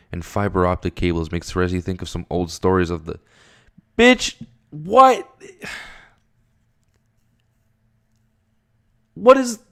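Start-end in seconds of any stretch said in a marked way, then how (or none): 4.0-9.6 very high, loud disbelieving cry; shocked outburst. Real, not acted. Reacting to the chapter's twist, a very high loud shocked cry. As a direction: blurt it out in total disbelief.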